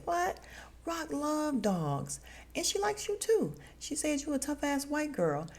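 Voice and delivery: high, slowed exaggerated voice; mock surprised voice. Recapping the show, imitating the character's surprise about Rock. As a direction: squeal it with mock disbelief. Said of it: "and he says".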